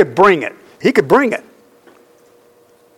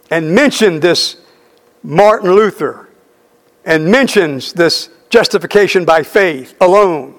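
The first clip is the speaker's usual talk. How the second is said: ordinary talk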